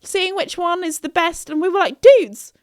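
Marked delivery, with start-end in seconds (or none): 0.0-1.5 high-pitched, carefree reply; super chill. Retold or imitated. Friends browsing shampoos while locked in, super chill. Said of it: "super chill about it". 2.0-2.5 loud, high, exasperated plea; urgent warning. Retold or imitated. Phoning friends trapped in the shop, urgent shout.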